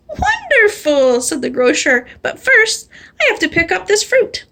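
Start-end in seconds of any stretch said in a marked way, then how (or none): 0.1-1.2 slow, high delighted wonderful; high, drawn-out joy. Acted. Grocer delighted. 2.2-4.4 chirpy grocer. Acted. Grocer voiced high.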